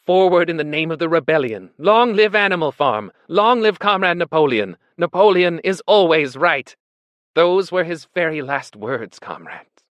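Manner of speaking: high, loud, slogan-like oratory; declaiming Squealer. A narrator's reading. Squealer relaying last words to animals, declaiming. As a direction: proclaim each slogan, then drop away at the end.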